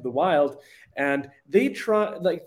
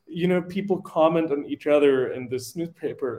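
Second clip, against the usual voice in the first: ordinary talk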